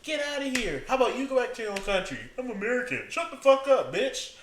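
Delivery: high-pitched mock heckles; acted heckler shouting someone down; heckling crowd.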